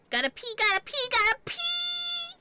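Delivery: loud, very high squeal; high-pitched playful urgency. Pretend. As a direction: squeal it high, stretching the last word. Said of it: "saying pee in a very high-pitched voice".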